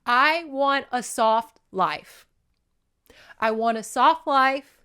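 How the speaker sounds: drawn-out weary plea; tired pleading. Retold or imitated. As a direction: whine for an easier life. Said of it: "I was like".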